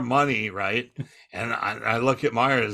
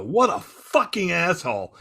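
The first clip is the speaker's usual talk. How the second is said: higher, mock exasperated venting; retold ranting; 6 semitones higher than usual. Comedian vents to Myers backstage, retold ranting. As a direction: retell yourself going off about someone. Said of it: "I'm just going off".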